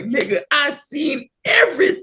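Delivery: high, slow, wailing exclaim; neighbor witness. Retold or imitated. Neighbor across the street insisting he saw everything. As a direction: cry it out like you saw it all. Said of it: "be like".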